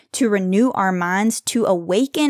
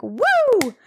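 high whooping cheer, much higher; excited scream; 12 semitones higher than usual. Real, not acted. To her listeners after the verses, a joyful whoop. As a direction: let out a joyful "Woo!" at the top of your voice. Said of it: "wanna just scream".

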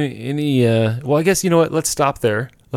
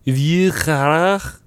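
drawn-out, higher French pronunciation; French accent; 5 semitones higher, much slower than usual. Shown as a demonstration. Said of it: "I know that's French".

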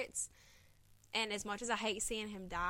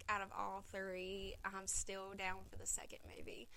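whisper; 8 dB quieter than usual. Real, not acted. Confessing unpopular opinion.

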